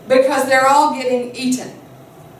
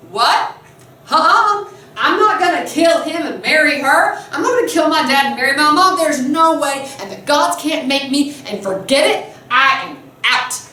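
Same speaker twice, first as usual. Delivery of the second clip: higher, protesting acted teenager; indignant refusal; 3 semitones higher than usual. Fifteen-year-old Oedipus rejecting the oracle's prophecy, indignant. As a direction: refuse it outright, hammed-up disbelief. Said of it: "behaved in just exactly the way you could imagine".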